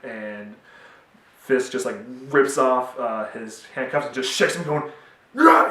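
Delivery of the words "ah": high, loud mock yell of rage; hammed-up enraged shout; furious yell. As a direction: burst out with an acted roar. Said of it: "just yells at him".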